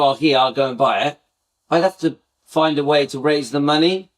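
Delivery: ordinary delivery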